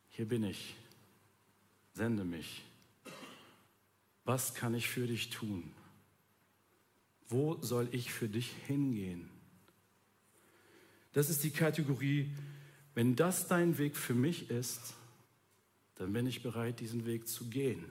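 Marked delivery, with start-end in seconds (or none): 0.1-9.3 devoted quiet offer. Retold or imitated. Voicing devoted believer. 12.9-17.8 humble readiness. Retold or imitated. Voicing devoted believer.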